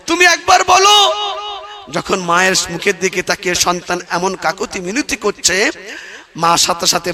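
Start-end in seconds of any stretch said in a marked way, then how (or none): 0.1-1.1 high shouted plea to his mother; desperate shout. Acted. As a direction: cry it out, pleading, much louder. Son begs mother, second take.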